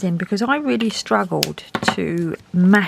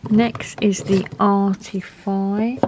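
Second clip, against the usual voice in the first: ordinary talk, nothing marked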